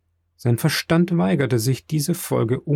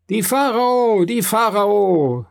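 high, drawn-out jubilant call; crowd's shout; 9 semitones higher, 4 dB louder, slower than usual. A narrator's reading. Crowd hailing the returning ship, high drawn-out shout. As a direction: call it across the harbour, stretching the words. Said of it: "riefen alle Stimmen".

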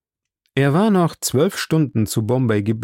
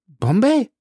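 much higher, a little louder, rising; startled cry; 11 semitones higher, 4 dB louder than usual. A narrator's reading. Passepartout alarmed at the mention of Bombay, high startled rise. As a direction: jolt up in alarm on the word. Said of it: "rief Passepartout".

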